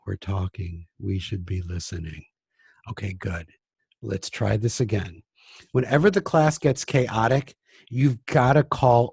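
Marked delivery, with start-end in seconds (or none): 0.1-2.2 put-on soft, low kids' reply; hushed class answering together; quiet voice. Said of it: "they'll answer in a quiet voice". 2.9-5.2 soft, low praise; softly reset the class; quiet voice. Retold or imitated. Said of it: "I'm talking in this quiet voice".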